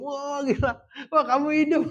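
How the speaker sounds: high, loud cry of relief, acted out; retold amazed outburst. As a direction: act out an overjoyed sibling's exclamation. Sibling's relieved greeting after near drowning, retold. (seruan kaget bercampur lega, menirukan kakak)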